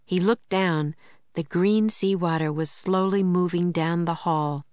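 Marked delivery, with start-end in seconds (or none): none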